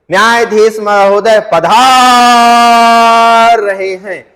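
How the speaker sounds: long drawn-out announcing call; court crier's call. Shown as a demonstration. Doorkeeper announcing the judge, drawn out and loud. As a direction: stretch every word like a court herald. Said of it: "तब दरबान कैसे चिल्लाते हैं".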